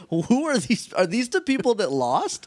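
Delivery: high, half-laughing mock fright; mock scared. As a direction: play it spooked and baffled. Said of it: "legitimately scared for a second".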